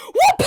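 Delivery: high, loud swooping whoop; cartoonish action whoop. Canoer flicks spider off with oar, triumphant whoop.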